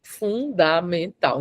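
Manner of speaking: "fundamental" slowly in separated syllables; fun-da-men-tal, each syllable of "fundamental" its own beat; syllabic emphasis. Shown as a demonstration. Coach repeating the punctuated key word, syllabic emphasis. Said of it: "eu pontuei o fundamental".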